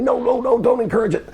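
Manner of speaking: high pleading protest; storyteller mimics a friend begging the guys to stop, high-pitched; imitating Tommy. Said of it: "Tommy's going, trying to interrupt me".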